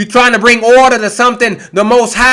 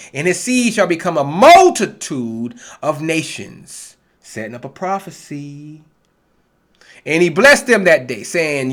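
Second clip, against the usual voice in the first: scripture reading; 5 semitones lower, 4 dB quieter than usual. Acted. Preacher reading Genesis to his online flock, measured reading voice. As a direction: read the verses like a preacher at the pulpit.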